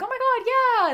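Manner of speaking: high, loud squeal of recognition; mock excitement. Imitating people who get the joke, mock excited. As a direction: gush as the friend who gets it. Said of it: "they're like".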